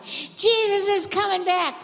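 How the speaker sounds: high, full-voiced shout; loud rallying yell. Shown as a demonstration.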